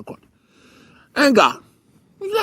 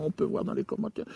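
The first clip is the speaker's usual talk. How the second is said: whispered aside; 13 dB quieter than usual. Real, not acted. Aside, voice drops away.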